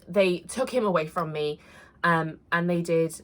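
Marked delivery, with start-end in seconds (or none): none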